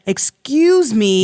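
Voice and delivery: drawn-out, insistent excuse me. Retold or imitated. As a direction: stretch it slowly to get someone's attention. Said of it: "He gets louder".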